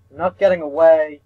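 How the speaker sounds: loud and high, chasing; shouting after a fleeing classmate; shouted threat. Acted. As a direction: yell it after her down the stairs. Chasing Katori down the stairs.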